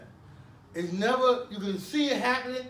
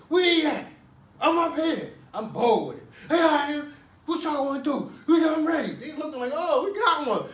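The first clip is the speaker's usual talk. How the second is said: loud, high calls back and forth; taunting shout; 7 semitones higher, 6 dB louder than usual. Retold or imitated. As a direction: dare them to come up. Retold shouted standoff with the squad.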